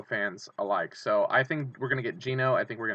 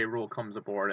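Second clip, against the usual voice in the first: ordinary talk, nothing marked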